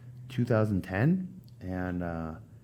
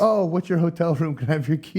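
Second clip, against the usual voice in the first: fawning woman; 6 semitones higher than usual. Retold or imitated. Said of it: "fawning over all the different wrestlers".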